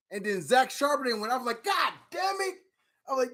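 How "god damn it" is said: high, strained mock exasperation; a high, pinched retold groan of frustration; exasperated curse. Retelling frustration as picks vanish.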